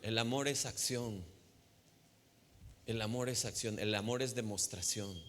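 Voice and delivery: ordinary delivery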